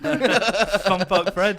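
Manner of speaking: drawn out and laughing; slow laughing riff. Real, not acted. Riffing on Fun Park Fred, slow and laughing. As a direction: savour the name, laughing.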